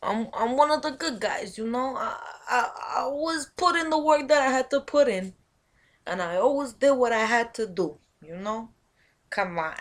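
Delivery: put-on movie mobster Italian-American accent, pleading then menacing; Italian mafioso. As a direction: play an Italian mafioso wronged by Charlie. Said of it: "Italian mafioso movie".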